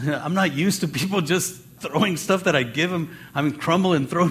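usual speaking voice

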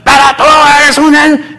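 high, strained mock yelling. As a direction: act out someone shouting in an argument. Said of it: "Cuando alguien sube el tono de voz".

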